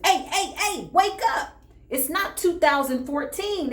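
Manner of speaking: high-pitched, emphatic retold scolding; urgent wake-up call. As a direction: rouse someone stuck, voice pitched high. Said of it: "I literally had to tell the person".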